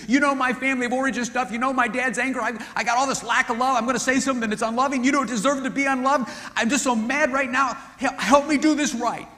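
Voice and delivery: high, loud, earnest plea for help; a man confessing his anger and begging for help; pleading husband. Retold or imitated. Husband admitting anger to wife, loud earnest plea.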